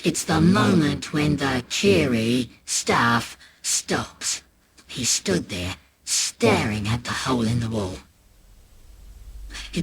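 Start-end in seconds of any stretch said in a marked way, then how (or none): 0.0-4.4 slow ominous repeat. Acted. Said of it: "repeated slower".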